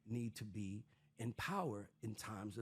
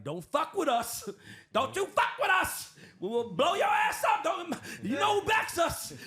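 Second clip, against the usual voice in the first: furious shout; 10 dB louder than usual. Retold or imitated. Israel voiced yelling a threat at its enemies, loud. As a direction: bellow it like a cornered tough guy. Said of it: "And they're like".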